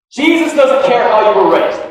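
loud imagined telling-off; blunt rebuke. Pretend. Pastor wanting to stop a young guy in his tracks, blunt loud rebuke. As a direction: cut him short, loud and forceful. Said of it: "stop this dude in his tracks".

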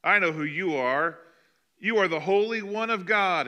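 high, loud possessed shout; high, loud demon's challenge; demon crying out. Acted. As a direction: let the evil spirit cry out at the top of his voice. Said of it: "cried out at the top of his voice".

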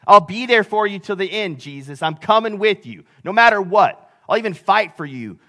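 impassioned promise, punched out phrase by phrase; fervent pledge. Retold or imitated. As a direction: swear loyalty on the disciple's behalf. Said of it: "the one he pledged his life to".

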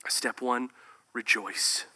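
usual speaking voice

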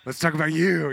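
hammed-up loud, high gushing; mock-cheery, high and loud; over-bright deflection. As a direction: gush loudly to dodge the question. Acted nervous deflection to client's question.

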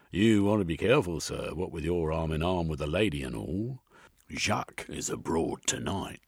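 a London copper stopping strangers on the street; gruff police sergeant. A narrator's reading. Sergeant warns of Jack.

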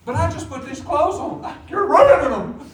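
hammed-up loud complaint; acted protest. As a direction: whine back at a parent's order, building to a yell. Acting out protest over bath.

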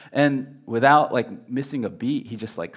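usual speaking voice

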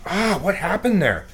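a startled oh, what happened; half-puzzled surprised mutter; mild surprise. Retold or imitated. Retold surprise after bad step.

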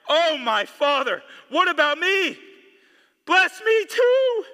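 a son begging his father for a blessing in a loud bitter cry. Acted. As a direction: cry out to your father in anguish, full voice. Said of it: "this is gonna get loud in here".

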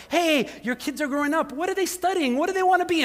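high, eager questions to a parent; bright curious asking. Retold or imitated. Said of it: "where I've said".

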